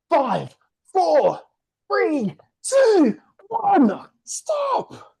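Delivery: high loud countdown; excited countdown. Shown as a demonstration. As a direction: count them down, shout stop. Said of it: "countdown called out".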